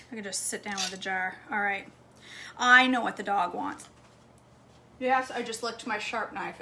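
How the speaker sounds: ordinary talk, nothing marked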